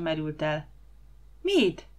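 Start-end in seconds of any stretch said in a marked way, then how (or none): 1.4-1.9 put-on a girl's shouted question, pitched high. A narrator's reading. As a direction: call out across the water. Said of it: "kiáltotta Anikó".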